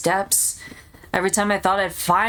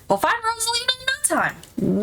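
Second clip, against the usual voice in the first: chipper; 13 semitones higher than usual. Acted. Cheerful upbeat reassurance.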